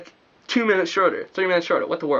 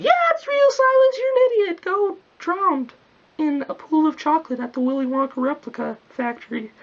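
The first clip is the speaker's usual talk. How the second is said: mocking friend voice; 11 semitones higher than usual. Friend telling Silas Nova Scotia is real, high mocking voice. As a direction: squeak out the insult like a mocking friend.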